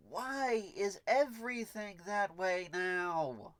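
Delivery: slow, high whiny complaint; whining. Real, not acted. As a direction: whine it out, drawn out and pleading. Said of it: "I realize I sound like I'm whining".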